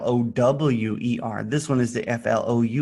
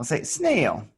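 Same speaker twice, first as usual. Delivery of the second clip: ordinary delivery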